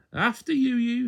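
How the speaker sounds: chirpy high twin, holding the door; high-pitched cheery twin. A narrator's reading.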